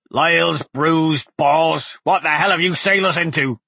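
exasperated cursing captain voice; panicked captain. Acted. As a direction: curse in outraged disbelief.

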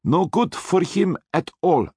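put-on menacing Austrian accent, slow; heavy Austrian accent, threatening. A narrator's reading. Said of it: "menacing Austrian accent".

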